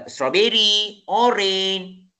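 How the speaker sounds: STRAWBERRY and ORANGE each held up, then a pause; comma pauses in a list. Shown as a demonstration. Teacher showing pupils how commas sound, comma pauses in a list. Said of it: "Ini adalah sebutan yang ada koma".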